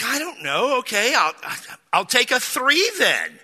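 high-pitched, grudging concession; retold huffy compromise; irritated. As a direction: give in with exaggerated irritation. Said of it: "Now I'm irritated".

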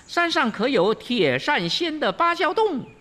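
high, bright monkey king calling out to the woodcutter; high monkey voice hailing an old woodcutter; cheeky Wukong. Acted. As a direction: sound like the Monkey King, perky and curious.

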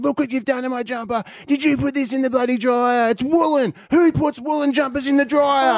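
high-pitched outraged daughter; imitated screaming. Daughter confronts dad over her shrunk jumper, mock scream. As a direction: scream it like an indignant teenager, mockingly. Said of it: "screaming, going".

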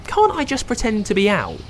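high hopeful princess voice; high and wishful; Rapunzel voice. Acted. Rapunzel thinking.

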